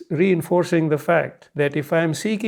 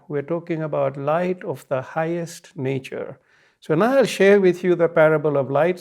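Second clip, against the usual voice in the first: ordinary talk, nothing marked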